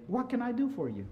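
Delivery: soft, high, tender question; gentle offer. Retold or imitated. Jesus comes to you offering help, gentle offer.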